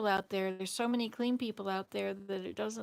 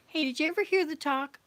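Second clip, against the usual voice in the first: excited call out; 5 semitones higher, 5 dB louder than usual. Real, not acted. Suddenly starts a new story.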